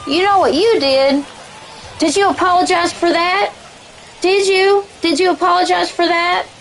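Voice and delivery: high-pitched mock interrogation; Little Mama. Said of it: "Little Mama or whatever his name is".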